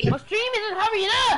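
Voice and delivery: loud, high-pitched complaint; frustrated yell. Real, not acted. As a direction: yell it in exasperation. Yelling about the stream lagging.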